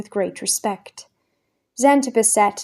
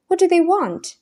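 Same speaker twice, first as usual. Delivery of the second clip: higher, alarmed question; panicky; 10 semitones higher, 4 dB louder than usual. A narrator's reading. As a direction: blurt it out in sudden panic. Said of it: "suddenly panicky".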